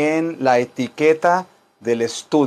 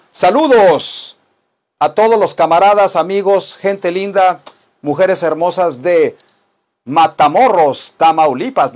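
radio dj shoutout; 2 semitones higher, 4 dB louder than usual. Pretend. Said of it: "radio-style shoutout".

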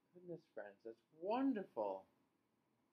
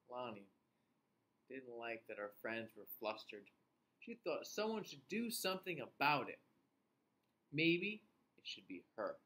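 story reading; 4 semitones lower, 3 dB louder than usual. A narrator's reading. Reads story to children.

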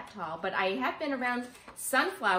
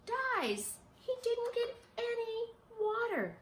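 mock sadness; slower than usual. Sad turn in the story.

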